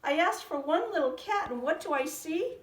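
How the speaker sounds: wife scolding husband over all the cats; upset old woman. A narrator's reading. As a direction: scold him in disbelief. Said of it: "she cried".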